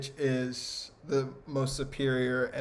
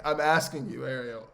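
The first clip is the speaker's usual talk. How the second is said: usual speaking voice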